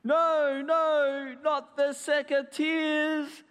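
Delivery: high, drawn-out cry of dismay; hammed-up wail of protest; dramatic cry. A narrator's reading. Basil pleads with the gardener, crying out. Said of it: "cried Basil".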